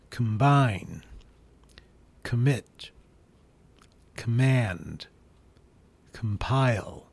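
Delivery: each "com" said as kəm, stress after; slow list, reduced "com" in every word; schwa com words. Shown as a demonstration. Teacher reads the com word list slowly. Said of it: "I'll read a few of the words".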